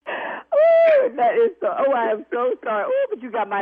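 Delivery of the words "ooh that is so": high, loud laughing cry. Real, not acted. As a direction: squeal through laughter. Laughing relief after prank.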